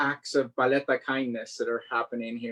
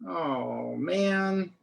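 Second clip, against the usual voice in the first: ordinary delivery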